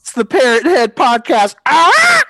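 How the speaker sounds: loud excited hoot; excited shout. Real, not acted. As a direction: yell it out gleefully. Excited shout after joke.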